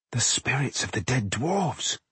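soft, slow realisation; hushed and drawn out, as if seeing ghosts; hushed awe. A narrator's reading. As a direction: breathe it out slowly in wonder. Realising the attackers were ghosts.

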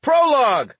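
usual speaking voice

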